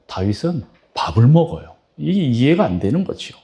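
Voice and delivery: ordinary talk, nothing marked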